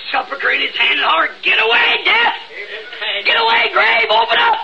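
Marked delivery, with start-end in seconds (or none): 1.4-4.6 1 triumphant shout. Acted. Voicing Christ rising from tomb.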